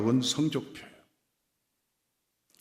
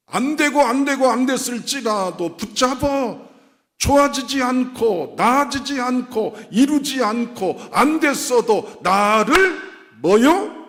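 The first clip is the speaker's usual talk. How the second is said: high-pitched, driving sermon delivery; full-force pulpit exhortation; impassioned preaching; 10 semitones higher, 5 dB louder than usual. Real, not acted.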